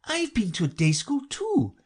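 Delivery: put-on high-pitched girl's voice; Alice. A narrator's reading. Alice claims day school too, high girlish voice. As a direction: chime in lightly and high. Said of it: "said Alice".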